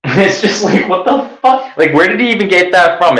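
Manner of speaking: can't believe what you heard; high-pitched outraged what the fuck; incredulous. Real, not acted. Disbelief at the accusation.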